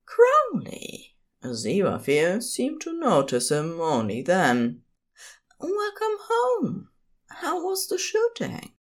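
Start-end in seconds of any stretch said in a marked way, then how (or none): 0.1-1.1 much higher, startled gentle partner crying his name; a sudden delighted gasp of a name; surprised cry. A narrator's reading. Aziraphale startled by Crowley's hug, high surprised cry. 5.6-8.7 fond partner voice. A narrator's reading. Aziraphale greets Crowley.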